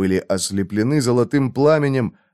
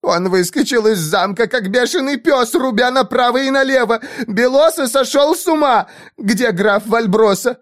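high panicked woman's cries; 12 semitones higher than usual. A narrator's reading. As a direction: cry out in terror. Panicked woman keeps wailing.

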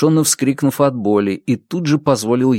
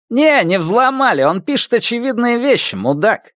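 much higher, scornful troll voice; sneering follower; 12 semitones higher than usual. Acted. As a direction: sneer it like a rude teenager.

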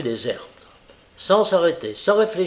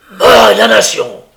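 loud, higher roar of rage; furious oath; 6 semitones higher, 15 dB louder than usual. A narrator's reading. Sykes learning of the betrayal, furious roar. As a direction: explode into a curse.

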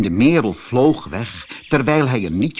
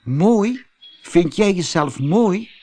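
hoog en luid uitgeroepen, ongelovig; uitroep; 9 semitones higher, 5 dB louder than usual. Acted. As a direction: roep het verbaasd uit als een lieveheersbeestje. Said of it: "riep het lieve heersbeestje". (hoog en luid roepen)

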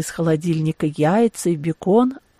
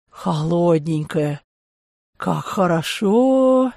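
slow, drawn-out relish; blissful drinker; much slower than usual. A narrator's reading. Hungover man relishing his first cold beer, blissful. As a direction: savour every word slowly.

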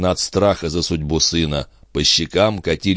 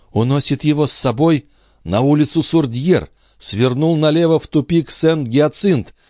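high, rapt voice following him; mother following the doctor in a vision, high; entranced seer; 7 semitones higher than usual. Acted. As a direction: keep watching in the trance.

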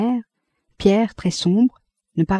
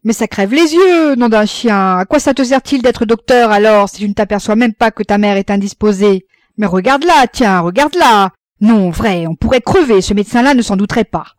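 loud, high-pitched indignant rant; exasperated scolding at full voice; angry outburst; 3 semitones higher, 8 dB louder than usual. A narrator's reading. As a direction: lose your temper at your son. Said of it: "Alors Roland se fâcha".

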